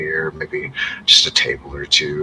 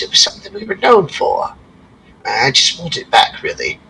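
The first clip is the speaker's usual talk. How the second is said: lightly voiced worried dwarf, higher; dwarf innkeeper; 5 semitones higher, 4 dB louder than usual. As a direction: plead for the lost lantern.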